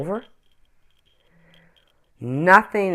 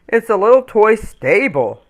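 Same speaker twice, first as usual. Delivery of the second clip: louder, kindly tree; big tree voice; 6 semitones higher, 8 dB louder than usual. Acted. Tree Brutus answers the small ornament, lightly voiced. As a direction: answer like a gentle giant tree. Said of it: "answered Brutus".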